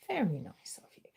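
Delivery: soft unvoiced whisper to the cat. Real, not acted. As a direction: breathe it to your pet.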